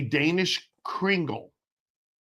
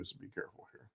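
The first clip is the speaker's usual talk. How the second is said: whispering; 8 semitones lower, 25 dB quieter, faster than usual. Real, not acted. Quiet aside while cutting pastry.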